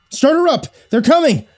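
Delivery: high, panicked shout; loud, high cry of alarm; raw panic. A narrator's reading. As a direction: yell a warning in panic. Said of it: "the raw panic in Thomas's voice".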